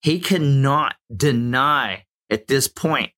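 ordinary talk, nothing marked

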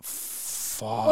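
breathless whispered fuck; voiceless defeated fuck; whispered curse. Retold or imitated. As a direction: caught out, curse under the breath. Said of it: "I was like".

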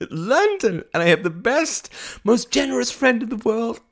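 high, wailing words with sobbing laughs; high, overwrought crying voice; tearful breakdown. Acted. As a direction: pour it out, close to hysterics. Tearful breakdown to her friend.

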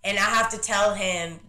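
usual speaking voice

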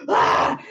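loud startled scream; mock scream. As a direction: jump at the scare. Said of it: "it's like".

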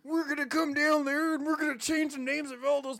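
high, loud Southern caricature; rally loudly as a caricature Southerner; mock Southern accent. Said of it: "Southern accent comes from the British accent".

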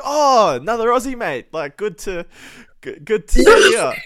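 loud, high mock-delighted Aussie; delighted greeting. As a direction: hail a fellow countryman, hammed-up. Australian delightedly greets supposed compatriot.

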